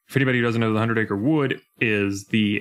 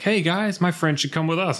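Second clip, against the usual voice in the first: a higher, hopeful Sora; imitating Sora; 8 semitones higher than usual. Sora asking Donald and Goofy to take Riku, higher and hopeful. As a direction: pitch it up, eager to include his friend. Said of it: "he does try to be like".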